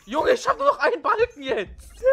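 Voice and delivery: high, loud laughing panic. Real, not acted. Nearly dead, laughing in panic.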